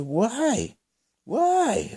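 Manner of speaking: high-pitched, incredulous protest; acted outraged questioning; indignant disbelief. Retold protest at being asked.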